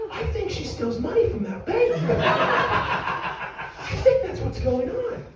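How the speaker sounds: very high-pitched conspiratorial theory; mock suspicion. Staff speculate about bank cleaner stealing, high mock suspicion. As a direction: squeak out the accusation, amused.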